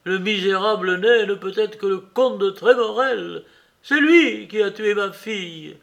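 the mayor accusing the count of killing his daughter; accusing shout. A narrator's reading. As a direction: hurl the name out. Mayor names the killer.

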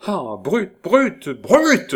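cri furieux, de plus en plus haut et fort; « brute » lancé trois fois en montant jusqu'au hurlement; colère folle. A narrator's reading. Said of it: "grinça-t-elle par trois fois avec une colère folle". (Marguerite hurle sa rage contre Joulou, furieuse)

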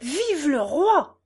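slow, high drawn-out cheer; acted royalist cheer, last word stretched; voice from the crowd. A narrator's reading. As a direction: cry it out from the crowd. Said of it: "dit une autre voix".

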